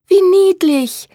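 high, loud, drawn-out delight; enthusiastic cry. A narrator's reading. Elise hears about the kittens, delighted high cry. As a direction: squeal it with slow relish. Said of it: "rief Elise begeistert".

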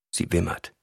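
usual speaking voice

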